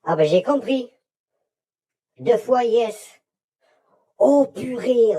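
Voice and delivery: high thrilled exclamations; excited. Real, not acted. Orb responds to questions.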